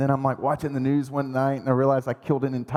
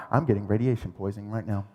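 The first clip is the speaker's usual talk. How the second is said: low, softer worried murmur; mock-panicked under the breath; hushed dread; 8 semitones lower, 6 dB quieter than usual. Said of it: "I'm like".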